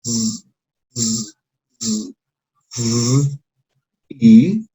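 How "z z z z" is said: a soft, long buzzing "z", held like a bee; quiet voiced z buzz, repeated; buzzing z sound. Shown as a demonstration. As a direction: drone "z" quietly between the teeth with the voice on. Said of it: "con una Z al final".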